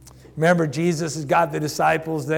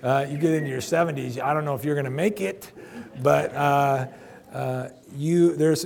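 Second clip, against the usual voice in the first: ordinary delivery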